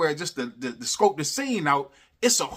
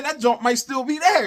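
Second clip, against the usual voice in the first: loud and higher, fired up; excited shout; 8 semitones higher, 4 dB louder than usual. Retold or imitated. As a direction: shout it like you just had the idea. Excited quote to friend about money.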